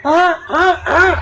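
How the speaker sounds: high falsetto sighing moans; mock dainty, swooning falsetto; camp effeminate whimper. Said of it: "tapi pas ngomong". (rintihan manja melengking ala bencong)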